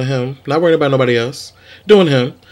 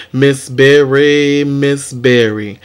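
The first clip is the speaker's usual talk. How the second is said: drawn-out praise; much slower than usual. Acted.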